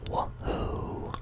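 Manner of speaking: whispering with a mouthful of jawbreakers; sucking candy. Real, not acted. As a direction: talk around candy in your mouth. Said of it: "I can't even really talk like this".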